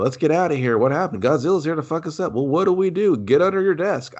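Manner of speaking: hammed-up evacuation panic; mock panic. Imitates panicking film extras fleeing.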